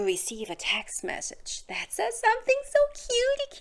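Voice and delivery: secretive whisper. Real, not acted. Telling viewers about a text, whispered. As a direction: hush it like a secret.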